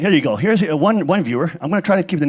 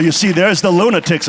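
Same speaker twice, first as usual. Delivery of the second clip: ordinary talk, nothing marked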